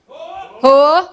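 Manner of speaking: loud, high Army hua; loud gung-ho soldier. Shown as a demonstration. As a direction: bark it like a soldier sounding off. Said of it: "If you're in the Army, sound off".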